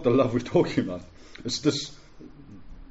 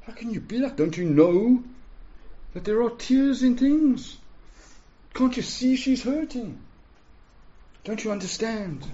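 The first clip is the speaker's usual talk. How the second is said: indignant protest; 9 semitones higher than usual. Acted. Imagined protest at Jesus.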